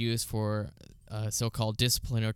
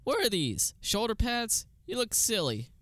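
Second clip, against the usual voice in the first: high, teasing jab at the villain's outfit; mocking; 11 semitones higher than usual. Hero mocking a villain's shoulder pads, high and teasing. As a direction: poke fun like a smug kid.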